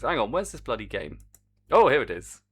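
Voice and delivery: ordinary talk, nothing marked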